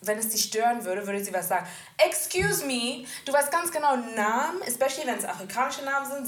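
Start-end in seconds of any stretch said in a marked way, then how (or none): 2.0-6.3 mock indignant telling-off. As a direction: act out an affronted correction. Acts out an indignant confrontation.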